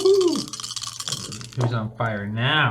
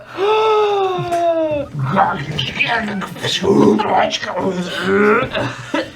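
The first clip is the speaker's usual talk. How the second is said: high strained animal gasps and grunts; gasp, then snarl and screech as you morph into an ape; gasping into wild ape cries; ape transformation noises; 11 semitones higher than usual. Acted. Polymorphed into giant ape, transformation noises.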